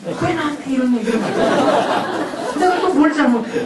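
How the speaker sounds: put-on defensive teen, wary and nervous; suspicious kid. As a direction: voice a kid who thinks he's in trouble. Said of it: "머릿속이 복잡할 거예요". (불안해하는 아이 흉내, 방어적으로)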